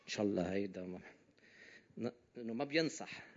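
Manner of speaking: grumbling under breath. Retold or imitated. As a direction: grumble it to yourself. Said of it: "كرهاني، عم بيقول".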